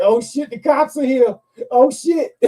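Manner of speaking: high, rising alarm at the cops; alarmed oh shit. Retold or imitated. Seeing cops arrive at the GameStop, high alarmed exclaim. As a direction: spot the cops and panic, half laughing.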